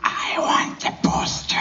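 fast creepy whisper; Gollum whisper. Pretend. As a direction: hiss it like Gollum. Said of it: "He's being creative".

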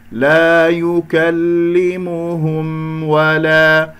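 "لا يكلمهم ولا" chanted slowly, final م sounded before و; clear م. Shown as a demonstration. Teacher asking students to listen, slow chant of "لا يكلمهم ولا". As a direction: keep the م in "يكلمهم" distinct. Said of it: "انتبهوا للميم كيف سمعناها مظهرة".

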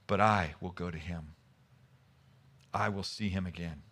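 low, subdued, with long pauses; solemn, resigned. Acted. Grieving David answering his servants, low and solemn. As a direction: let the voice sink into quiet acceptance.

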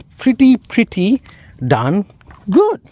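gushing, saving the peak for good; building excitement. Real, not acted. Car reviewer gushing about the Saga's value, building up to an excited high good. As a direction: pitch climbs to a high squeal on good.

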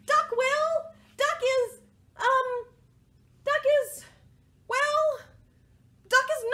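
high, loud proclamation with searching stops; hesitant group cheer. Acted. Animals volunteering duck for the job, high halting cheer. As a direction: cheer high, stalling for a compliment.

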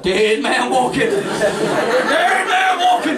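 slow, stretched prison-march announcement; hammed-up, drawn-out film quote; drawn-out mock call. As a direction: stretch out every word like a death-row call.

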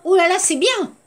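high, pleased oh là là; delighted oh là là. Shown as a demonstration. Teacher demoing the positive oh là là. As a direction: sound thrilled, it's good news.

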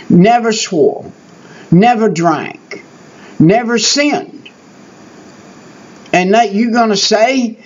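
normal talking voice

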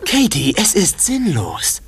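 normal talking voice

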